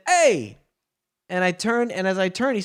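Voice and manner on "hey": loud, high mock call; the guy calls again, loud and high; shouted hey. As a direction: yell it out to get attention. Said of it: "He said".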